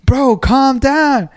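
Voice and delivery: high, drawn-out plea; retold exasperated appeal. Worker telling jet skier to calm down, retold exasperated appeal. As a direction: mimic arguing back, stretching the words out high.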